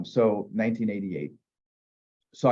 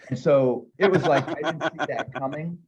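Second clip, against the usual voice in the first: higher, laughing through the words; 6 semitones higher than usual. Real, not acted. Recalling the homeowner tossing his magnet, laughing. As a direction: laugh off the rejection.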